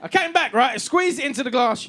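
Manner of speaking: ordinary talk, nothing marked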